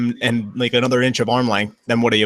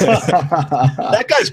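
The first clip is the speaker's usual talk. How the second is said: laughing; 5 semitones higher than usual. Real, not acted. Laughing at a joke.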